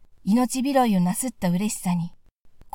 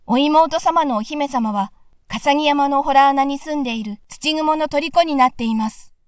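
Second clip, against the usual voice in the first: talking dog; 4 semitones higher, 4 dB louder than usual. Acted. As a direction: report like an eager hound. (主人に答える犬の声で)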